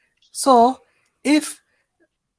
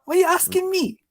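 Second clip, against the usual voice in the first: put-on higher-pitched Somali uncle accent; 5 semitones higher than usual. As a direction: protest it in a raised Somali accent. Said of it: "sounds a bit kind of authentic".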